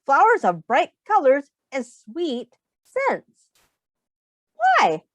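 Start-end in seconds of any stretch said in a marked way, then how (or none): 4.6-5.0 a much higher, playful "why?"; curious question. Pretend. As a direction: pique the kids' curiosity. Teacher poses a question to kids.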